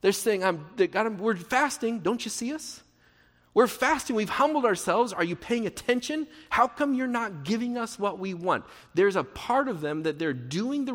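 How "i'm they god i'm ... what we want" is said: high, pleading whine of people demanding notice; mock the showy fasters begging for attention; lightly hammed-up entitled whining; whiny complaint. People demanding God notice their fasting.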